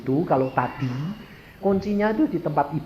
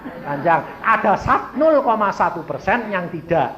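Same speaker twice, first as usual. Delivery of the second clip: high, loud pulpit delivery; full-force preaching; 7 semitones higher, 6 dB louder than usual. Real, not acted. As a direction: drive it home to the whole congregation. (khotbah lantang berapi-api)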